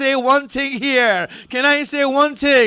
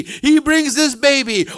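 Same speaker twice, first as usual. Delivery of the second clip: ordinary talk, nothing marked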